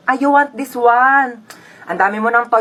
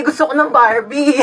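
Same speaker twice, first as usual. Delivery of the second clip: put-on whiny kid voice; 3 semitones higher than usual. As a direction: whine it like a spoiled kid. Kid whining again.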